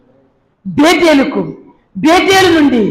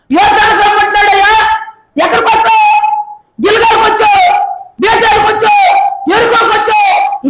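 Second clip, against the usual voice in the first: exasperated shout; 10 semitones higher, 3 dB louder than usual. Acted. Elijah rebuking Elisha.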